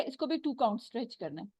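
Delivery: ordinary talk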